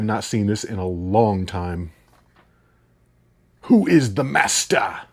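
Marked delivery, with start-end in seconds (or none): 3.6-5.0 movie villain quote. Acted. Quoting The Last Dragon line.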